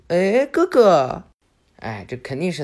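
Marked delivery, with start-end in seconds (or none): 0.1-1.2 high, loud, slow call of big brother; cheerful greeting. Acted. Boruto turning round to greet Konohamaru, high glad call. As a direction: greet him brightly.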